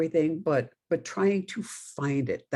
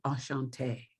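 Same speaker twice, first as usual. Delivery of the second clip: put-on soft, quieter Enchanté in a Budapest accent; a light Budapest accent, said softly; 7 dB quieter than usual. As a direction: greet the class gently with a Budapest lilt. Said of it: "this really thick accent".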